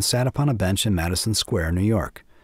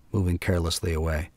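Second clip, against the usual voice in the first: ordinary delivery, nothing marked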